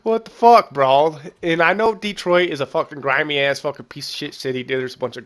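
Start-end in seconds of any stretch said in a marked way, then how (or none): 0.0-1.2 mock outrage. Real, not acted. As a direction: blurt it out in amused outrage. Outraged at the game insulting Detroit.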